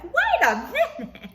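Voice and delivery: high, loud burst that drops away; mock outraged outburst. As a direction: explode on WAIT, half laughing. Mock outrage at husband's suggestion.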